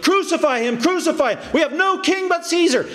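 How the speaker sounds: high-pitched crowd cry; fickle mob. Retold or imitated. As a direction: call for it like a mob. Voicing the mob before Pilate.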